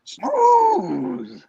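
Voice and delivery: very high, drawn-out "Smooth"; Mister Furley impression. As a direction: squeak it out like a tipsy old landlord. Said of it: "and then he would always go".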